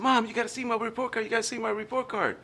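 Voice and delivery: put-on high, fast, begging child voice; pleading kid. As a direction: rush it like an impatient boy. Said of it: "And I said".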